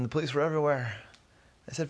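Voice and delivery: usual speaking voice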